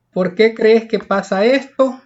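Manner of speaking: usual speaking voice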